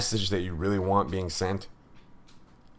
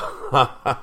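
short, real scoffing chuckle; scornful laugh; 5 semitones higher, 5 dB louder than usual. Real, not acted. Host laughing at claim of best officials, derisive chuckle. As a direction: laugh it off in disbelief.

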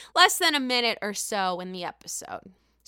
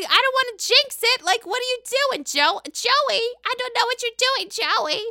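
much higher, exasperated imitation; mock Teresa whine; 11 semitones higher, 4 dB louder than usual. Teresa snapping at her brother, in a high mock whine. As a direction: play her flustered and defensive. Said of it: "she's like".

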